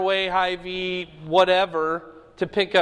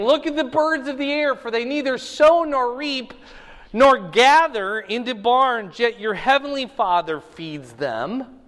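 scripture reading; 7 semitones higher, 5 dB louder than usual. Acted. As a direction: proclaim the verses to the room. Reads scripture aloud to class.

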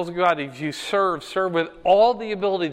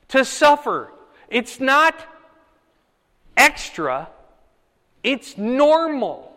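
rising preaching fervor; 7 semitones higher, 3 dB louder than usual. Real, not acted. Pastor preaching with rising force.

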